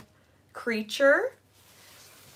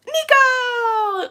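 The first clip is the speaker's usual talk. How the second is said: high, loud delighted call; excited greeting; 9 dB louder than usual. Real, not acted. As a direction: call out the name with glee. Greeting a viewer by name.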